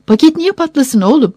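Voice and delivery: incredulous parental retort; exasperated mother. A narrator's reading. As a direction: brush off a child's fear impatiently. Impatient mother answers frightened son.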